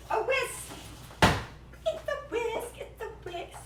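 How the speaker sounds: high, delighted squeal; excited squeal. Acted. Cook finding her lost whisk, squealing high with delight.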